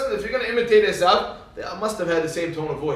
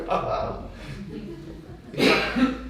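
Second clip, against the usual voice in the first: breathy amused laugh; 6 semitones higher, 4 dB quieter than usual. Real, not acted. Preacher laughs at his childhood story, amused laughter. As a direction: let a laugh trail out after the punchline.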